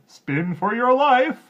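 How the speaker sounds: high, very slow loud threat; loud command. A narrator's reading. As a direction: bellow the order. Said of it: "he said".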